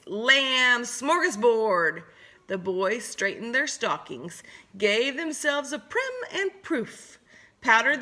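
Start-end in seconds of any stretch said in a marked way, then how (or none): 0.1-2.0 very slow, savoring gloat. Acted. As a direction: relish every syllable. Wolves gloating over lambs.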